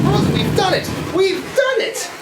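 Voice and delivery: high, loud shouting with a laugh; triumphant shout. Acted. Charlie cheering to Rosie after the blast, high joyful shouting. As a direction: yell for joy as the ship blows.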